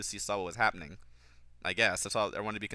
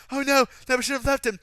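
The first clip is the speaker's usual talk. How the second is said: very high, loud, whiny parody; mock wail of remorse; exaggerated mock dismay; 12 semitones higher, 8 dB louder than usual. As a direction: ham up the regret to absurdity. Repeats the mocked line, exaggerated.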